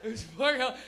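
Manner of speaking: normal talking voice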